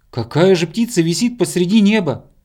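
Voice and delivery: high, loud disbelieving question; incredulous. A narrator's reading. Bear cub questioning hedgehog about the bird, high incredulous voice. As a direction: scoff loudly at the guess.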